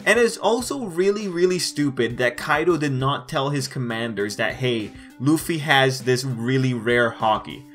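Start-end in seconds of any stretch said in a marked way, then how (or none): none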